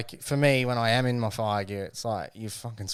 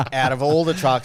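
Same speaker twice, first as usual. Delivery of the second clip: ordinary talk, nothing marked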